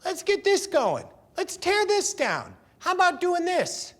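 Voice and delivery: high, eager egging-on of the crowd; rallying the crowd. Retold or imitated. Singer egging the kids on to wreck the club, excited. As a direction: whip the kids up, pitched high.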